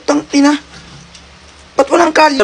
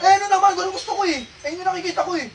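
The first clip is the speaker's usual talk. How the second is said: high defiant talkback; 10 semitones higher, 4 dB quieter than usual. Acted. Skit argument with courier.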